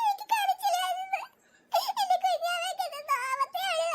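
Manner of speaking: high-pitched tearful wail; whimpering through tears, words drawn out; crying. Acted. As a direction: cry out in pity for the baby. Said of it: "ചേച്ചി എന്തിനാ ഇത് കരയുന്നേ".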